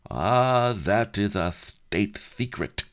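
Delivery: high leering drawl; slow, sly leering lecher. A narrator's reading. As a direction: leer over the secret. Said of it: "he leered".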